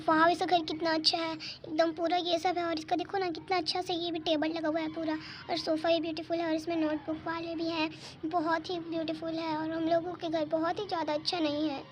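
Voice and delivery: softly admiring, talking to herself; quiet musing. Pretend. Admiring friend's house to herself. (धीमी आवाज़ में मन ही मन तारीफ़)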